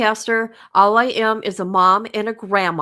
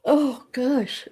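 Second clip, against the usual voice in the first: yawning; 4 semitones higher than usual. Real, not acted. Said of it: "sorry for yawning".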